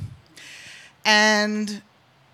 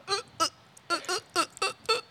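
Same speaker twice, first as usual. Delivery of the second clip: small, high choked gulps; mock struggle to get words out; imitated speechless stammering; 11 semitones higher, 7 dB quieter, much faster than usual. Young guy trying hard to talk, stuck in speechless stammers. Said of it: "he can't talk".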